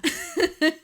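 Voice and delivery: high burst of laughter; laughing. Real, not acted. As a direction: laugh out loud. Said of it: "so bad".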